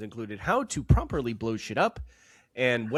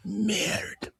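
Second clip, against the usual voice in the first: mock French; 9 semitones higher than usual.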